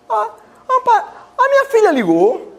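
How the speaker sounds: put-on high, far-off old mother, happily remembering; elderly mother's voice. Son imitating his forgetful mother, high old voice. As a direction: play a confused old mom, lifted up high.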